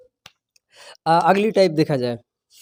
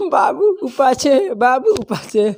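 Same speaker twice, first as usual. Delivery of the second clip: put-on higher, slow cooing baby talk; mock baby talk; 5 semitones higher, slower than usual. Teacher mocking a YouTube girl's baby talk, slow high coo. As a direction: coo it like a girl babying her boyfriend. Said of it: "एक video बनाती है YouTube पर लड़की".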